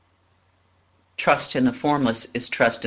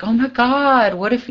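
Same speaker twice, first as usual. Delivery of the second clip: sudden high, louder burst of worry; panicky oh my God; 10 semitones higher, 3 dB louder than usual. Retold or imitated. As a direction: blurt it out like the worry just hit you. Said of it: "I'm like".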